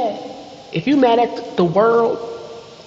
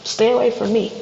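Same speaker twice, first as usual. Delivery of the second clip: normal talking voice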